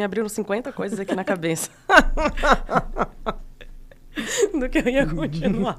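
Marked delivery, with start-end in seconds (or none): 1.9-4.5 real, loud high-pitched burst of laughter; long high laughing fit. Real, not acted. Overwhelmed by ideas, bursts out laughing. 4.6-5.8 talking through real laughter, higher pitched; words breaking into high laughter; laughing. Real, not acted. Loses her thread while laughing.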